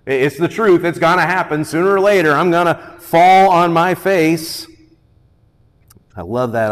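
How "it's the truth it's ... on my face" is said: building, louder declaration; emphatic preaching. Real, not acted. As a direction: drive the admission home from the pulpit.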